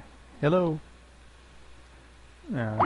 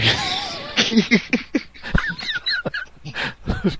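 long high, breathless laughing fit; helpless wheeze of laughter; real high wheezy laughter; wheezing laugh. Real, not acted. Reacting to prank call deal breaker.

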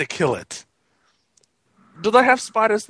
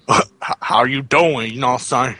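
put-on American voice; 7 semitones lower than usual. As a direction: play a deep-voiced American caricature. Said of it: "I'm American now".

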